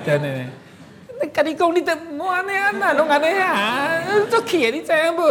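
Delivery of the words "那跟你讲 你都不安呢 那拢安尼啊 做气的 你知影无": very high sung-speech opera heroine; Taiwanese opera voice. Retold or imitated. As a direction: lilt it like a gezaixi actress scolding gently. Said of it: "有一个歌仔戏".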